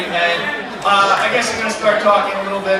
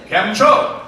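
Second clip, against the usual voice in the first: Gujarati greeting; 4 semitones higher, 6 dB louder than usual. Pretend. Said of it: "for my Gujaratis".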